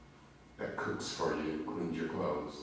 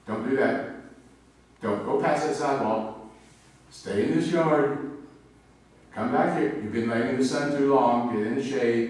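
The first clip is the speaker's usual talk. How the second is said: louder, higher scolding calls to a dog; overprotective scolding; 5 semitones higher, 6 dB louder than usual. Retold or imitated. Owner anxiously scolding pet dog Dasher to stay safe, loud. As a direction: fuss over the dog like a worried parent. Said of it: "I'm so overly protective".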